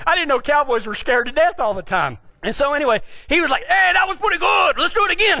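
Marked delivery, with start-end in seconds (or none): 3.6-5.4 higher, hollered enthusiasm; gleeful shout. Retold or imitated. Cowboy partner to the scared rider, gleeful shout. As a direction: imitate an eager partner calling out. Acted partner cheering after mishap.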